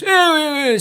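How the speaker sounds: high, loud eh eh; mock grunts. Host mimics the audience's wordless review, high grunting. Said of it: "half of that was just".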